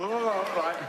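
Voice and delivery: high, drawn-out puffing-up groan; mock choking noise, swelling up; imitated frog-like swelling. Comic acting out the lady's allergic reaction, swelling groan. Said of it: "starts blowing up like a frog".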